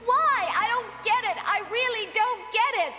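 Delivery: a desperate wish cried out; anguished outburst. Acted. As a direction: pour out the hurt of not being human. Lime's anguished outburst over Otaro.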